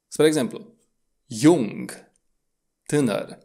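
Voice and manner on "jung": "jung" starting with a y glide. Shown as a demonstration. Teacher gives a Germanic example, "jung" with J like iarbă. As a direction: say "jung" as "yung". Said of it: "Se pronunță precum în cuvântul românesc iarbă".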